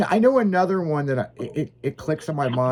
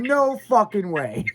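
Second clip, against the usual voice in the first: higher, drawn-out retold disbelief; 7 semitones higher than usual. Retelling his reaction to the swamp hotel, stunned disbelief. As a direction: refuse it in drawn-out, stunned amazement. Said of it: "I about dropped my cell phone".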